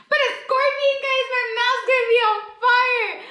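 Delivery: high, loud and thrilled; excited squeal. Real, not acted. Dreading the scorpion pepper heat.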